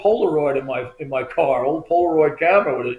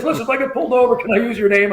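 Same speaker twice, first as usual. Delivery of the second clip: higher-pitched, keyed-up phone voice; imitated hurried friend; 7 semitones higher, faster than usual. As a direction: play a buddy calling in a rush. Friend asks favour by phone.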